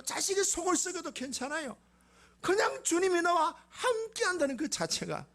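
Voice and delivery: high, fervent answer to God; fervent plea. Acted. People answering God fervently, acted. (높은 목소리로 간절히 고백하듯)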